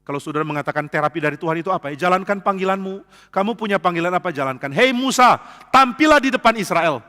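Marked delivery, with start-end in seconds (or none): 4.8-7.0 calling out. Acted. God calling Moses.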